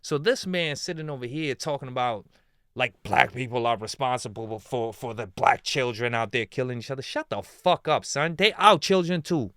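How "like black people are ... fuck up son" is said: ordinary talk, nothing marked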